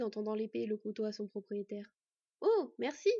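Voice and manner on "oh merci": higher, louder, pleased thanks; delighted. A narrator's reading. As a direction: thank them with glad surprise. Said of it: "se réjouit son jeune frère".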